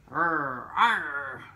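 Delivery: low mock pirate arr; playful pirate arr; pirate growl. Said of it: "that sounded like a pirate".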